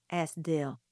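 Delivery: plain reading voice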